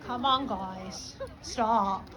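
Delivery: low, soft, slow limp plea; a half-hearted request nobody obeys; weak pleading. Shown as a demonstration. Trainer mocking a timid officer at crowd control, soft and limp. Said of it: "Nobody's gonna take that seriously".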